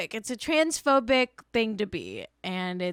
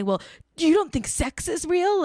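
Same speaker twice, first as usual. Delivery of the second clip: mocking terf voice; 7 semitones higher than usual. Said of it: "They say".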